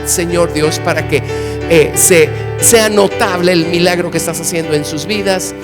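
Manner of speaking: earnest, steady prayer voice. Acted. Interpreter leads a prayer. (oración fervorosa)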